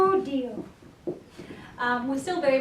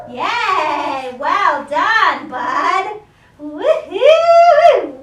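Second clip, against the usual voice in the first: excited cheer; 3 semitones higher, 9 dB louder, much slower than usual. Real, not acted. Trainer celebrating her dog's retrieve, loud cheer. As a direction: whoop it up for the dog.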